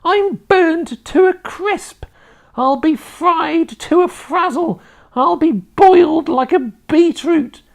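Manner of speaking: high, loud crone lament; yelling old woman. A narrator's reading. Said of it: "she yelled".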